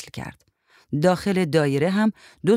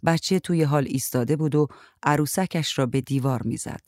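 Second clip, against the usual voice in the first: plain reading voice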